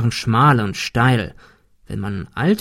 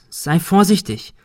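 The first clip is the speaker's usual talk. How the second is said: mildly worried warning, higher; 5 semitones higher than usual. A narrator's reading. Cecil warns Ben before he climbs, worried. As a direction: caution a friend with a touch of concern. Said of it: "Cecil klang besorgt".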